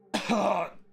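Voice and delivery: sharp high cough; harsh cough. Real, not acted. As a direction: cough out the bug. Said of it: "a fruit fly just went down my throat".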